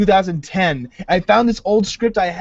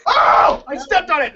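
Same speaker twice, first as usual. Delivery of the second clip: fake alarm; 9 semitones higher than usual. Fake accident fetching sword.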